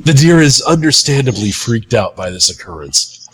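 ordinary talk

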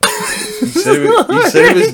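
high squeal of laughter; cracking up mid-sentence; laughing. Real, not acted. Teasing friend after the call.

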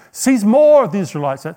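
usual speaking voice

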